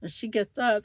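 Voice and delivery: ordinary talk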